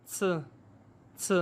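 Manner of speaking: څ said as a crisp ts, tongue on teeth; teacher modelling the c sound. Shown as a demonstration. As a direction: hiss out a short ts for څ. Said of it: "څ پڑھا جاتا ہے".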